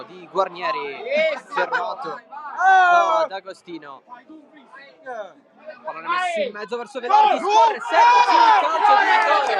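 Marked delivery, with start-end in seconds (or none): 1.5-9.5 loud, rising shout at the penalty call; bursting with excitement, shouting the call; excited shouting commentary. Real, not acted. Penalty awarded in live commentary.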